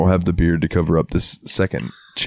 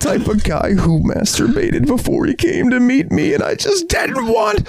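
much higher, slow, cry-shouting whine; high whiny mock shout. Mocking her disgust at the handshake, high whining cry. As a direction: wail it like a disgusted girl.

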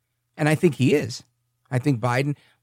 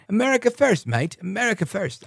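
higher, hammed-up British slogan; mock British accent; 7 semitones higher than usual. Host mocking a British Republican candidate, hammed-up British accent. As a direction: put on a jokey British accent. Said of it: "he's got this heavy British accent".

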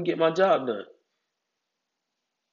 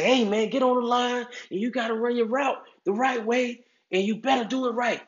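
coach yelling; 10 semitones higher than usual. Shown as a demonstration. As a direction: bark the order at the kid like a drill coach. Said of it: "Some kids you gotta yell at".

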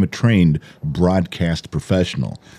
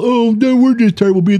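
high, whiny mock of the daughter's excuse; 13 semitones higher than usual. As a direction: mimic her lame excuse in a high sing-song. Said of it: "we get a call".

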